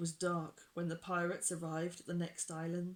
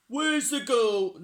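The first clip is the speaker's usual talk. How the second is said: loud, raised, drawn-out shout; wrong character voice; 5 semitones higher, 13 dB louder, slower than usual. A narrator's reading. Reader voices pirate chief wrongly, loud and high. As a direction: shout it slowly in a higher voice. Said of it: "No, that's the wrong voice".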